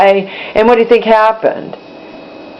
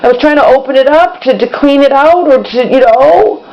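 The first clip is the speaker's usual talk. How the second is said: higher-pitched, pleading self-defence; apologetic to mom; 6 semitones higher than usual. Retold or imitated. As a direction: plead your case to mom in a raised pitch. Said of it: "my mom is just absolutely freaking out. I'm like".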